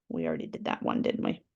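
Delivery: whispered playful aside to the class; soft breathy aside. Real, not acted. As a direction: drop to a whisper, teasing. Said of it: "whispered aside".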